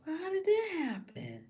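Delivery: very high, acted baffled question; mock bewilderment. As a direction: pitch it way up in feigned disbelief. Mock-innocent reaction, repeated.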